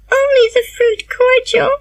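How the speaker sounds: put-on high, girlish and unhappy; unhappy answer. A narrator's reading. Anne answering Marilla, high and unhappy. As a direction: answer in a small sad high voice. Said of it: "answered Anne unhappily".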